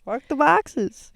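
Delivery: loud, hyped show-name shout; shouted slogan. Real, not acted. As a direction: yell the catchphrase.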